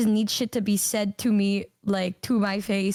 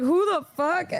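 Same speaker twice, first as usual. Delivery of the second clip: shocked; 7 semitones higher than usual. Retold or imitated. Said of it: "I was like".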